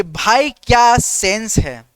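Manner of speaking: slow, drawn-out praise of a clever friend; slow and savouring, a touch of awe; slow admiring line. Shown as a demonstration. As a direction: stretch each word in mock admiration. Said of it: "एक और dialogue यहाँ पे".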